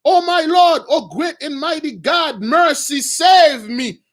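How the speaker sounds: high, loud pleading cry for mercy; desperate girl begging the prince; screaming plea. Retold or imitated. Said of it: "and then starts screaming".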